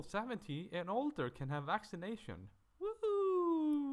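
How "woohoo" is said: cheering. Real, not acted. Cheering vaccination news.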